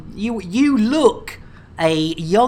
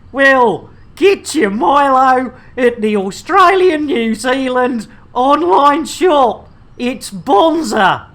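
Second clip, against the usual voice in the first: mock Australian ad read, higher and louder; hammed-up Aussie salesman voice; playful Australian advert voice; Australian accent; 8 semitones higher, 6 dB louder than usual. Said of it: "an Oz".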